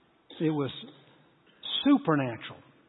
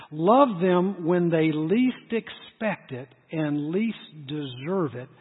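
much slower, every word weighed; slow emphatic repeat; slower than usual. Shown as a demonstration. Preacher repeating the key line to the congregation, slow and weighty. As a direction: drag it out so it sinks in. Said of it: "I want you to hear this".

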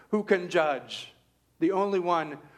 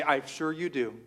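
dry, softer and lower, fading; answering his mom's "I love you more", teasingly soft; teasing reply; 6 semitones lower, 5 dB quieter than usual. Retold or imitated. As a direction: tease mom, dropping to a murmur. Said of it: "I say".

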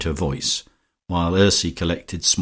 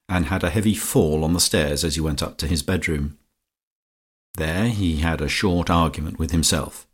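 ordinary delivery

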